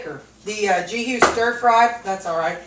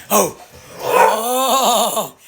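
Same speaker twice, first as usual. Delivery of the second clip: usual speaking voice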